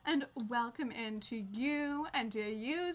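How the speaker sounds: ordinary talk, nothing marked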